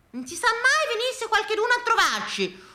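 high-pitched mimicry of the woman next door; mock her excuse in a high voice; high, fretful housewife impression; fussy neighbour. Repeats neighbour's excuse mockingly.

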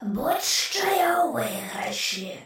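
slow unvoiced gleeful skull whisper; skull's whisper. A narrator's reading. The skull gloats from the corridor in a slow whisper. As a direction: hiss it slowly like a gloating skull. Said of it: "голос черепа".

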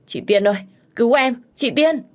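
high, loud scream for sister; desperate cry for help. A narrator's reading. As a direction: call out as a terrified hostage. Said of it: "đang gào khóc thảm thiết". (kêu cứu thảm thiết)

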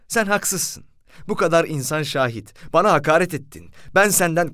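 high, rising accusation; accusing driver. Retold or imitated. Driver accusing the narrator before the police, high accusation. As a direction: point the finger at him.

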